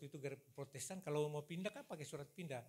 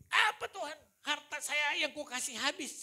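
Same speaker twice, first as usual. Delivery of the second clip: loud, high-pitched outcry of disbelief; shocked protest; 9 semitones higher, 10 dB louder than usual. Retold or imitated. Jumping up to answer God, loudly shocked. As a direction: re-enact a startled cry, pitched way up.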